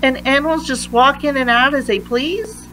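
plain reading voice

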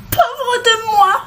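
very high, plaintive hen voice; wailing hen. A narrator's reading. Rescued hen lamenting her fate, wailing. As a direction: moan it in a high pitch. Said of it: "gémit-elle".

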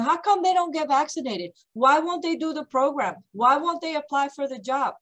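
baffled folks imitated. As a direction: voice puzzled outsiders, pitched well up. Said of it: "it's frustrating because I continue to see folks going".

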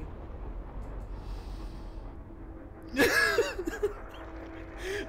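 breathless wheezing laugh; wheezing silent laugh. Real, not acted. Streamer to chat after the fail, wheezing laugh. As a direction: wheeze without voice.

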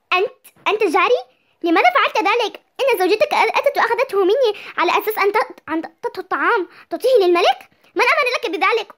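loud high accusing questions; angry confrontation. Acted. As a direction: demand an answer, outraged. Neighbor confronts over the pot.

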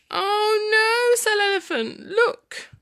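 high, drawn-out storybook wail; dismayed. Acted. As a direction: wail it as the upset little elephant. Elephant's dismay acted in story.

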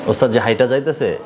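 usual speaking voice

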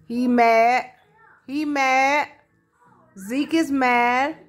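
mock-scolding pet talk; teasing the dog. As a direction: tease him for being mad, loud and playful. Teasing her sulking dog.

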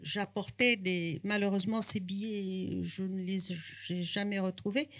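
usual speaking voice

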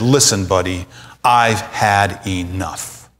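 low, slow, quiet fed-up warning; fed-up Jacob. Retold or imitated. Jacob telling Laban he's had enough, low and slow. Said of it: "very angry and contended with Laban".

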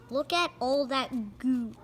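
ordinary delivery, nothing marked

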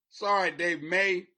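ordinary talk

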